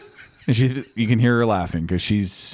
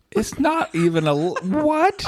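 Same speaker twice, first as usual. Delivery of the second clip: rising high into an incredulous squeak; mock disbelief; 12 semitones higher, slower than usual. Husband checking the time after being woken, mock incredulous. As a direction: break off, then shoot up on the question.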